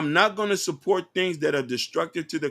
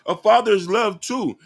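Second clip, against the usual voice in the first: sudden concession; 3 semitones higher, 8 dB louder than usual. Real, not acted. Concedes point loudly.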